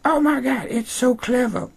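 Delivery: very high gushing falsetto; Emma Duvall. Acted. Host mocking the writer as Emma, gushing squeaky falsetto. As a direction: squeal it in mock self-congratulation. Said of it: "A K A Emma Duvall".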